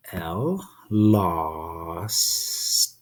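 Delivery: stretched-out lost, sound by sound. Shown as a demonstration. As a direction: sound out L then lost slowly. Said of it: "I hear three sounds".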